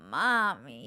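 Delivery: "mommy" said low, Spanish-accented, with rolling drawn vowels. Shown as a demonstration. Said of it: "they got that little Spanish accent with it".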